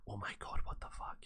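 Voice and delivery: unvoiced, stunned whisper; barely-there mimicked whisper of disbelief; whispered shock. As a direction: whisper it as a mock aghast reaction. Mimes her stunned look.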